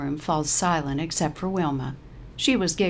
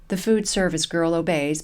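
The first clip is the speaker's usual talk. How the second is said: ordinary delivery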